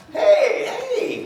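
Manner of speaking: high, squealing double hey; mock excited greeting. Greeting a friend spotted across the room, high excited squeal. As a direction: burst out like you just spotted an old friend.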